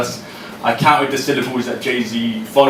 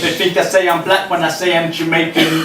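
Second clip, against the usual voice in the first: raised, ringing performance poem voice; higher and louder performance reading; spoken word poem; 6 semitones higher, 3 dB louder than usual. Acted. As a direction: deliver each line to the room like a slam poem.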